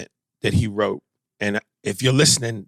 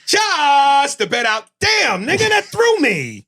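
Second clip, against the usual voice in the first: very high-pitched, loud exclamation; startled outcry; 4 dB louder, slower than usual. Real, not acted. Reacting to co-host's fart, startled high outcry. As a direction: shriek it in real disbelief.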